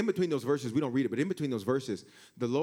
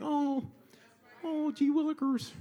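mock wimpy voice; 10 semitones higher, slower than usual. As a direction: play the timid pushover. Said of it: "God wasn't no punk like".

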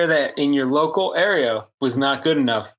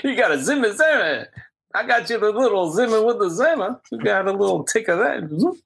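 put-on higher, lightly done comic old-man voice; a playful stab at a famous comedian's voice; Bill Cosby impression; 5 semitones higher than usual. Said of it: "As Bill Cosby says".

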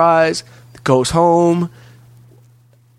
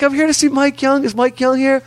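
very high put-on female voice; eager girl falsetto imitation; mimicked young woman; 12 semitones higher than usual. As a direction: pitch it way up like a woman rushing in. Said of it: "she, like, runs up to the thing".

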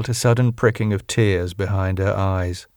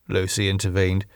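plain reading voice

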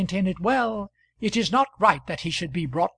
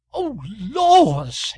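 louder, higher shocked cry; shocked exclamation; 5 semitones higher, 6 dB louder than usual. A narrator's reading. Friend shocked at the husband's swearing, exclaiming. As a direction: gasp it out, scandalised. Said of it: "exclaimed Miss Biggs".

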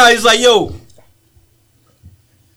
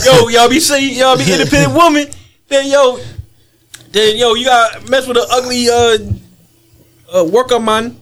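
put-on Jamaican accent; 6 semitones higher than usual. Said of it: "Why you got the Jamaican accent?".

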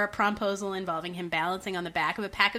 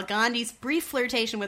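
ordinary talk, nothing marked

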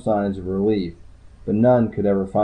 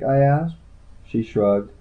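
ordinary delivery, nothing marked

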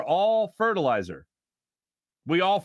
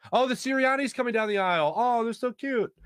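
gushing; 7 semitones higher than usual. Pretend. Gushing over imagined flower boys.

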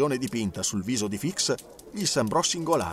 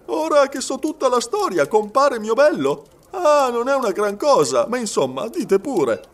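high, loud, breezy character voice; jovial Frenchman; 10 semitones higher, 6 dB louder than usual. A narrator's reading. Servant urging the detective to confess, jovial and breezy. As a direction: wave it off in a raised, boisterous voice.

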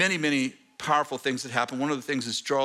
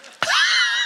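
sudden high laugh out loud; real laugh breaking out; bright high burst of laughing; burst of laughter; 7 dB louder than usual. Real, not acted. Laughing at her own remark.

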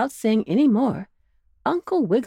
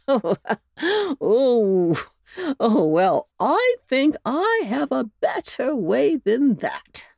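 with a laugh; 6 semitones higher, slower than usual. A narrator's reading. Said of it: "said Uncle Wiggily with a laugh".

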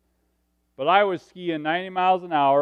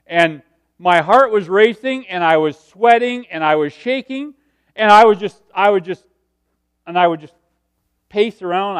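reliving the panic; 8 dB louder than usual. Retold or imitated. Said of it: "I had an anxiety attack".

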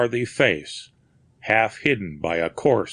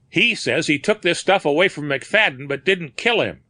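sneering; 7 semitones higher than usual. A narrator's reading. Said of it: "sneered Corcoran".

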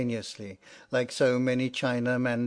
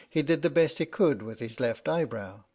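usual speaking voice

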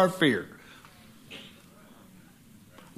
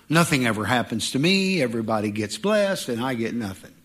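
whiny complainer; 7 semitones lower than usual. Retold or imitated. Preacher mocking a churchgoer who curses his own life, sulky low moan. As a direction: sulk like a sorry-for-yourself churchgoer.

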